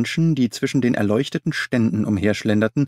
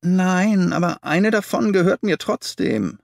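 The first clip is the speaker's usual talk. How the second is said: whimpering; 8 semitones higher than usual. Acted. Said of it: "wimmerte der kleine Mann".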